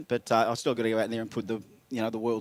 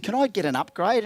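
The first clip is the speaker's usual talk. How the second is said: cheeky request; 7 semitones higher than usual. Retold or imitated. Retold cheeky request at counter.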